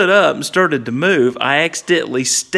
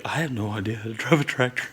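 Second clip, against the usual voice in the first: softer, fast, worried inner voice; private panic; 4 semitones lower, 7 dB quieter, faster than usual. Retold or imitated. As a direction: mutter the realisation to yourself. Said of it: "I was like".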